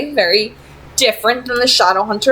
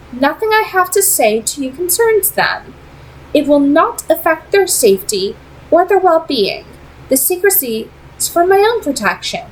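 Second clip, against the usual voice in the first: put-on higher, poised vampire lady with a faint accent; Camille; 8 semitones higher than usual. A narrator's reading. Vampire leader tempting Simon with an offer, poised and higher. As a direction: speak as a cool, persuasive vampire leader.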